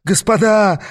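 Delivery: high, pleading lament; plaintive wailing. A narrator's reading. Devil appealing to the gentlemen holding him, plaintive. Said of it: "жалобно запричитал дьявол".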